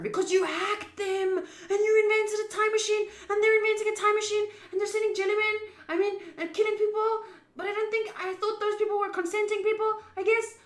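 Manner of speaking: high, loud panicked rant; frantic outburst. Real, not acted. Player yelling at the game characters, high and loud. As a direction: blurt it all out in a rush of alarm.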